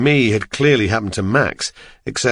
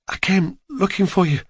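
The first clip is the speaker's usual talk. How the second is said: worried woman; 8 semitones higher than usual. Acted. Julia voiced by narrator.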